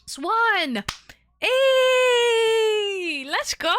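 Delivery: high, loud hype shout; excited cheer. Real, not acted. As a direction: yell out in celebration.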